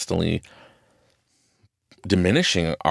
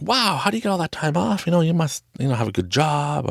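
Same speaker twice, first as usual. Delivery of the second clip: mock gushing admiration, pitched up; impressed onlookers; 10 semitones higher than usual. People hearing about his long Florida trip, impressed. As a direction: imitate wowed onlookers, much higher pitched.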